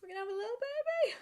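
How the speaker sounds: teary high-pitched repeat; emotional. Real, not acted. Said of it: "I'm so emotional".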